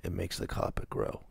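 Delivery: soft, conspiratorial half whisper; leaning in, quietly. Retold or imitated. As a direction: lean in and murmur the secret. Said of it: "he'd lean in and go".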